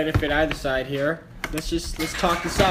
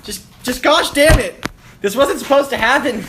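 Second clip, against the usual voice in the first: anguished outburst; 7 semitones higher, 5 dB louder than usual. Acted. Character learns family is dead.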